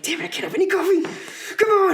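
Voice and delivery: high, fast outburst of frustration; loud, exasperated mock complaint, building to a yell; upset. Patient denied coffee by a headache condition, upset. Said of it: "I was more upset about caffeine".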